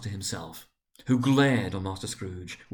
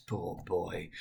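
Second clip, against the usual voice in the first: much softer and lower, full of pity; 4 semitones lower, 8 dB quieter than usual. A narrator's reading. Scrooge pities his lonely younger self, soft and low. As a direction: murmur it with sorrow for the lonely child. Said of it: "in pity for his former self".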